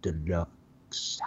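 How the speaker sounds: hushed dramatic whisper. Acted. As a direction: whisper the big reveal slowly. Dramatic reveal of number one.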